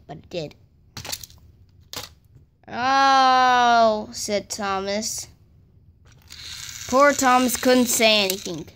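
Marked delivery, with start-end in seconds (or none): 2.7-4.1 loud crying "Oh" of distress; pained cry. A narrator's reading. Thomas knocked off the tracks cries out, hurt.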